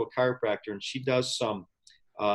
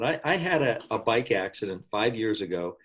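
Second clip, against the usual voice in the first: ordinary talk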